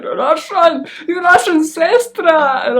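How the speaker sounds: loud hammed-up Russian accent, slow; playful loud Russian accent shout; mock Russian accent. Shown as a demonstration. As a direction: boom it like a Russian sister. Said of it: "Sounded Russian there".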